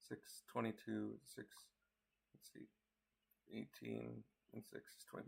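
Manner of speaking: quiet mumbled arithmetic; muttering. Real, not acted. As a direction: work it out under your breath. Working out the time aloud.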